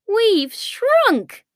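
high-pitched exclamation of shock; astonished. A narrator's reading. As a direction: blurt it out in sheer astonishment. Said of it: "Kai said in astonishment".

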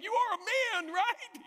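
very high, strained retort breaking into laughter; incredulous mock shout. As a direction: yell it comically high. Sergeant and recruit's shouted exchange retold.

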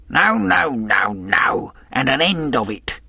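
high, loud outburst of refusal; angry cry. A narrator's reading. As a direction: cry out to end the argument. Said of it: "he cried once".

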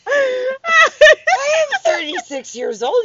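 high, loud, slow indignant wail; mock outrage shout. Real, not acted. Mock outrage about toilet.

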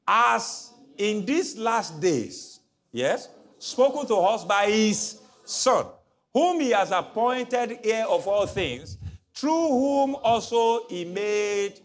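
slow, loud reading aloud; slow group reading. Shown as a demonstration. Preacher leading the church reading verse, slow and loud. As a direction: read it out slowly together. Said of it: "One, two, three, go".

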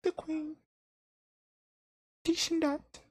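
put-on squeaky high parody British accent; silly high-pitched British caricature; mock British accent. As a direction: chirp it like a cartoon Brit.

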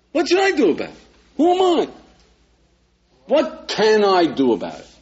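high, mock-incredulous shrug; a hammed-up "who, me?". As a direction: play the shrugging young man, voice up high. Imitating indifferent young man.

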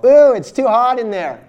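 mock a fireman who flinches from the heat; mock whiny fireman. Imagined fireman balking at the fire, high mocking whine. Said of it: "expect the firemen to run in and go".

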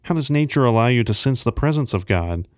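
normal talking voice

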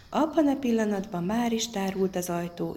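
ordinary delivery, nothing marked

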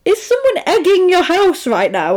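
high, incredulous retold question; mock disbelief. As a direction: imitate someone who can't believe it. Voicing Max amid flying eggs.

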